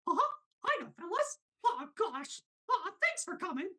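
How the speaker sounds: very high squeaky falsetto cartoon voice; high-pitched cartoon mouse; Mickey Mouse. Shown as a demonstration. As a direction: greet the fans in a tiny high falsetto. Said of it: "always do is Mickey Mouse".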